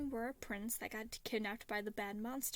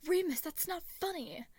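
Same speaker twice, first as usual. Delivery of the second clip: higher, louder annoyed shout; angry child yell; 7 semitones higher, 5 dB louder than usual. A narrator's reading. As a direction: yell it, stung by the slap. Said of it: "Roman yelled, angered".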